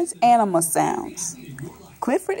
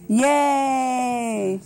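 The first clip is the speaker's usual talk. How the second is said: long, drawn-out cheer; celebratory cheer. Real, not acted. Cheering at the book's end.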